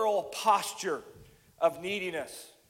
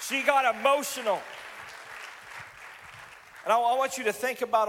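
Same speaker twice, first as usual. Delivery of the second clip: raised, louder preacher's voice; impassioned preaching; 5 semitones higher, 4 dB louder than usual. Real, not acted. Preacher urging the congregation to feel for Jesus, impassioned. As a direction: preach it high and loud to the room.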